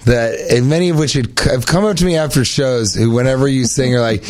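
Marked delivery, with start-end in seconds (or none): none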